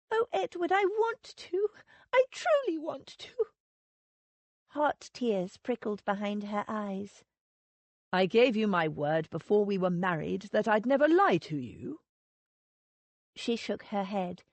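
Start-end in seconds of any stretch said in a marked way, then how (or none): 0.1-3.5 much higher, pleading and close to tears; tearful plea. A narrator's reading. Wife begs her husband to let her believe him, tearful and much higher. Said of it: "Her face crumpled". 8.1-12.0 louder husband's voice, earnest and hurt; a hint of offended honour; Edward, wounded. A narrator's reading. Husband reminds his wife of his promise, louder and earnest.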